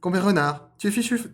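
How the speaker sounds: high, loud cartoon squeal; strained rabbit voice. Rabbit calling out Compère Renard, high loud voice. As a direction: push up into a squeaky character voice. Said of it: "elle est dure à faire, cette voix".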